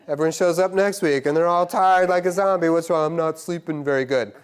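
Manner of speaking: loud, high, fervent preaching; preaching at full force. Acted. Preacher rousing the congregation, loud and high. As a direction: lift the room with it.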